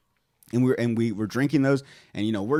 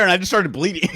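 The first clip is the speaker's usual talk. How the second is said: ordinary delivery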